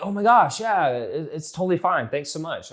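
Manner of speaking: high-pitched delighted client reply; gushing reply. Acted. Client thanks coach for the compliment, gushing reply. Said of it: "they're like".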